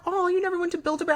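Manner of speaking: imitating husband John's disbelief; mock surprise. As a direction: sound amazed she never went. Husband John's surprised question retold.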